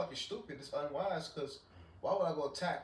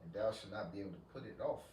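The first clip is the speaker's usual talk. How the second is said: scripture reading; 3 semitones lower, 6 dB quieter than usual. Acted. Reads Isaiah aloud.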